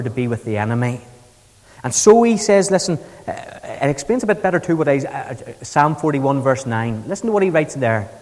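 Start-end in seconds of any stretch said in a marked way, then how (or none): none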